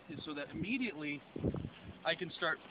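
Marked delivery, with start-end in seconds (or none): none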